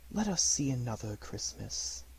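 a gentle ghost asking calmly; lightly voiced spirit. A narrator's reading. Spirit speaks.

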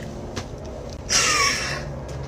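long high breathy swoon sigh; dreamy sigh. Real, not acted. Starstruck fan swooning over [name], dreamy sigh.

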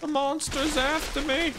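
high, drawn-out fake damsel cry; mock panic. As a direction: play at being terrified of a monster. Playful panic, fleeing a game monster.

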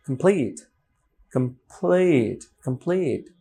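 "কমপ্লিট" with an intentional big puff on p; exaggerated aspirated P. Shown as a demonstration. Teacher exaggerating intentionally for learners, aspirated P. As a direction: stretch the stressed "plit" in "কমপ্লিট", aspirating the p. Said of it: "বেশি হবে হচ্ছে এখানে, intentionally".

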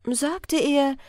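ordinary delivery

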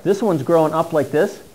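ordinary talk, nothing marked

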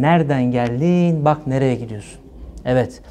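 ordinary talk, nothing marked

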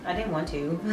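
normal talking voice

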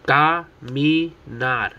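caminar broken into CA, MI, NAR; split into syllables. Shown as a demonstration. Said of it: "Three syllables".

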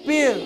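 "Pill" with a short i; brief, unstretched "Pill"; short vowel. Shown as a demonstration. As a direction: end the vowel of "Pill" at once. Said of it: "একবারে শেষ".

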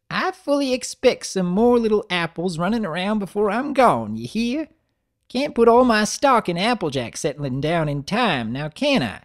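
put-on high, creaky-old grandmother voice; old granny voice.

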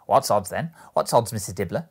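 loud, challenging questions; bold defiance. A narrator's reading. Woman challenges Missus Dibbler and the others, defiant. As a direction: throw it out as a brazen challenge. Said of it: "looking with bold defiance".

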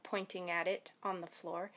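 ordinary delivery, nothing marked

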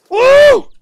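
very high, loud "woo"; loud whoop. Real, not acted. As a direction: whoop to let off steam. Lets off stress.

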